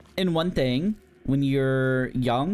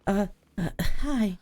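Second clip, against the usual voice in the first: shy newcomer; 8 semitones higher than usual. Retold or imitated. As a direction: squeak it out like a nervous newbie. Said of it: "the user role-playing".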